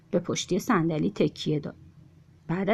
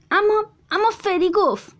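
put-on protesting child; 12 semitones higher, 4 dB louder than usual. A narrator's reading.

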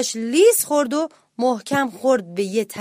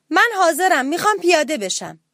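high, raised-voice call; shouted announcement; 6 semitones higher, 4 dB louder than usual. A narrator's reading. Little mouse with backpack on deck, shouting to get off. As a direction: call out eagerly to be let off. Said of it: "داد زد".

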